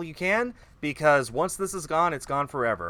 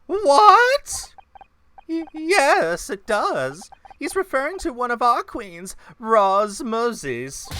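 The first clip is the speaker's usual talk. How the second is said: startled high character voice; 6 semitones higher, 4 dB louder, slower than usual. Acted. Game character Fran reacts startled.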